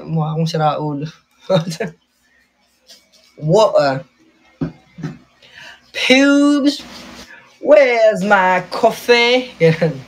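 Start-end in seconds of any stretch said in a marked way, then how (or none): 3.4-4.0 mock British accent with a dropped t. Vlogger copying her sister's British accent. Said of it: "British accent. Tinatry ko copyin si ate". 5.9-9.5 high, slow, drawn-out mock British; British accent. Vlogger copying her sister, hammed-up British accent. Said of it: "British accent. Tinatry ko copyin si ate".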